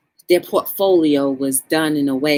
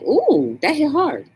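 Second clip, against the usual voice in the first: impressed ooh; 8 semitones higher, slower than usual. Retold or imitated. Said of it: "I'm like".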